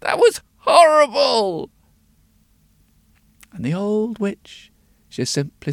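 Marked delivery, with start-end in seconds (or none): 0.0-1.7 high, loud, crying out; disgusted old woman. A narrator's reading. Old woman to the witch after drinking, loud wail. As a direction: wail at the foul taste. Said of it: "she says".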